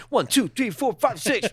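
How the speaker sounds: high-pitched comic count-off; frantic mock counting. Gunman counting shots into the deputy, high mock count.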